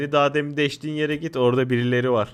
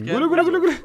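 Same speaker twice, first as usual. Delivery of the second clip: frantic high-pitched panic; being chased; 14 semitones higher, much faster than usual. Real, not acted. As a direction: run run, squealing in panic. Fleeing, squealing nonsense.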